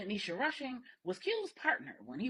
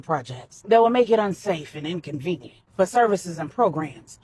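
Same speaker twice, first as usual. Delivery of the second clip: put-on a lightly lowered, firm older man; Manny, firm and protective; 2 semitones lower, 10 dB louder than usual. A narrator's reading. Manny warns Corey his island is safe, lightly voiced and firm. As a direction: lay down the law on his own turf, steady.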